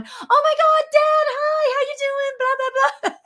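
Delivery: very high, bubbly, laughing gush; gushing excitement. Retold or imitated. Speaker acting out greeting her late dad, high giddy gush. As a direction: gush at Dad like seeing an old friend. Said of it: "me being me going".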